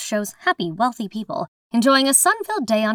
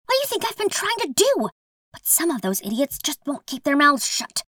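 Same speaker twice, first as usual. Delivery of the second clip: higher voice; 7 semitones higher than usual. Acted. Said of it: "a higher voice responds".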